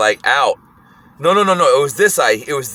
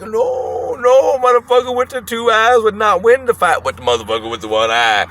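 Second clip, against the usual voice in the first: imitated old man, indignantly high; old man's indignant denial; 8 semitones higher than usual. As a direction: protest high, then drop on the last words. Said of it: "He's like".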